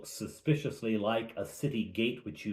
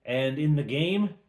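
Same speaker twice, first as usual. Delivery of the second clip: ordinary delivery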